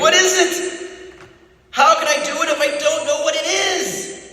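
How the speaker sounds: high, pleading, frightened cry; desperate cry. A narrator's reading. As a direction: beg for an answer in panic. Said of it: "Winston cried".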